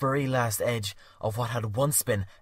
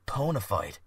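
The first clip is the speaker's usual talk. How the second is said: ordinary delivery, nothing marked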